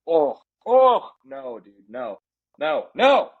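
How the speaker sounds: groaning, exaggerated refusal; mock horror. Horrified by imagined Belichick ad.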